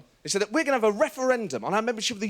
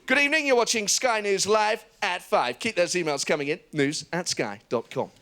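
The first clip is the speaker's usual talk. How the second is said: authoritative newsreader delivery; mock news anchor, grave and measured; Sky News anchor voice. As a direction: parody of a live Sky News presenter. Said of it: "On Sky, they do this".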